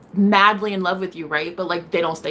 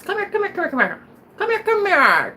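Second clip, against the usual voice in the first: higher sing-song come here; mock-menacing sing-song; taunting sing-song; 12 semitones higher, 4 dB louder than usual. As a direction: coax with a teasing lilt. Taunting call, pitch jumps.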